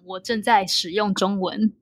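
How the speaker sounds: ordinary talk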